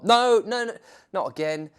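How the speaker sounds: high no no, then fed up; exasperated protest. Real, not acted. Narrator catching the editor's error again, exasperated. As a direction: groan at the mistake.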